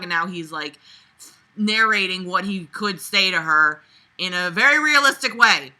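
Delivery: normal talking voice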